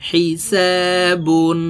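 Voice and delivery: হিসাবুন drawn out very slowly; drawn-out word. Shown as a demonstration. Teacher models the finished word slowly for learners. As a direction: stretch হিসাবুন long and clear. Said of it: "practically বানান করে বলে দিচ্ছি".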